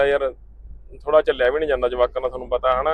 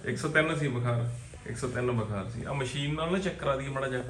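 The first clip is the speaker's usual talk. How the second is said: much softer, gentle and reassuring; 9 dB quieter than usual. Real, not acted. Father softly coaxing his feverish son to take medicine first.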